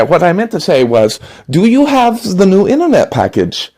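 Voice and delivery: put-on smooth it over in a German accent. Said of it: "German door-to-door salesman".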